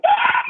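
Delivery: high mock shriek of panic; comic high-pitched freak-out scream; inner panicked scream. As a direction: scream as if inwardly terrified, hammed-up. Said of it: "On the inside, I'm like".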